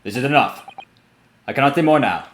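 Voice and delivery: lightly voiced Russian guard, curt; a hint of a Russian accent, ending the talk. Guard cutting off questions, light Russian accent. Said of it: "I might be trying to do their accent".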